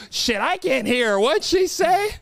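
put-on high, slow old-man complaint, rising into the question; hard-of-hearing grandpa. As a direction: plead high and baffled at missing the words. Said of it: "Vernon can't hear very well".

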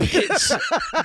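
laughing, high exclamation; cackling outburst; high laughing bitch. Real, not acted. Laughing reaction to joke.

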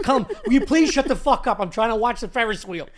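loud, high and laughing; mock yell. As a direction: snap at him while laughing. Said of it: "I was like".

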